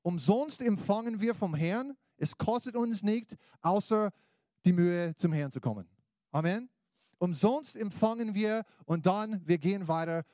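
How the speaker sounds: loud, high preacher's call; rousing sermon voice; preaching. Acted. Preaching climax, louder and higher.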